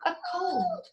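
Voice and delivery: plain reading voice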